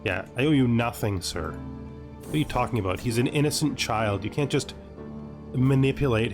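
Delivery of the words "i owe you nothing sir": ordinary talk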